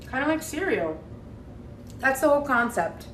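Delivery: talking through a chewed bite of pancake; words pushed around a mouthful of food; chewing while talking. Real, not acted. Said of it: "Sorry for the obnoxious chewing".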